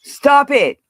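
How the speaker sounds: sharp loud "Stop it". Real, not acted. Streamer tells her mods to stop, loud and sharp. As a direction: cut them off loudly.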